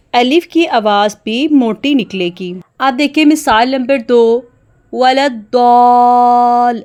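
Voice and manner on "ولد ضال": ضال drawn out with a heavy, full alif after داد. Shown as a demonstration. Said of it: "داد کے ساتھ الف کی آواز موٹی ہوگی". (ضال میں الف موٹا اور لمبا)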